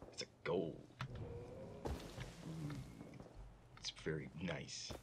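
hushed, breathy whisper. Real, not acted. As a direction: whisper it in awe. Sudden whispering over loot.

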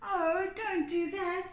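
high, pleading goat voice. A narrator's reading. Middle goat begging the troll, high pleading voice.

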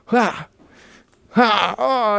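sudden pained ah oh; pained groan. Real, not acted. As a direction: groan as a muscle pulls. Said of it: "I just pulled the muscle".

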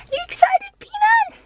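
very high squeal to a dog; excited pet talk. Real, not acted. Owner asking Peanut about the trip, high excited pet voice. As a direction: hype up the dog in a sky-high voice.

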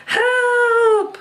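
mock cry for help. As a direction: yelp for rescue. Pizza calls for help.

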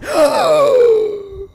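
sharp high gasp of fright; startled loud gasp; loud frightened gasp. Real, not acted. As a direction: suck in air loudly in shock. Jump scare.

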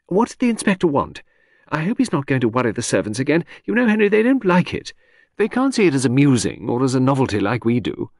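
breezy chatter that floats from topic to topic; Lucy, lady of the house. Acted. Chatting to her husband in the garden, airy high lady.

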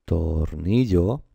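tornillo drawn out in slow syllables, NI held; sounded out. Shown as a demonstration. Teacher sounds out the stress.

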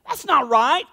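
half-shouted "whoa, wait"; mock angry objection; frustrated outburst. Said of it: "extreme frustration and anger".

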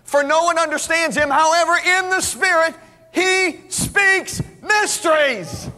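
high, rising preacher's cry on mysteries; fervent scripture reading. Acted. Preacher proclaiming scripture to the congregation, slow rising shout. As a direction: build the verse to a slow ringing shout.

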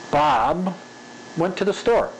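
long pause after "Bob", then the rest in a run; changed spacing. Shown as a demonstration. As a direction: set "Bob" apart, then hurry "went to the store". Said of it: "That's one way".

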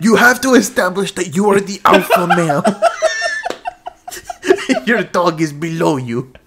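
loud half impression dissolving into laughter; laughing Caesar Milan attempt. Imitating a dog trainer for a friend, laughing loudly. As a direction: try the dog trainer but keep laughing. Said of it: "I don't have a Caesar Milan impression".

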